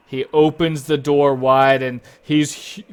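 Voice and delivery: ordinary talk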